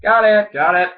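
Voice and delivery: excited. Real, not acted. Cheering after solving the lid.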